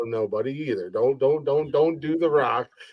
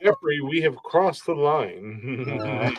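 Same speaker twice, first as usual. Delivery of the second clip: slow dramatic line; 3 dB quieter, much slower than usual. Pretend. Slow deadpan catchphrase line.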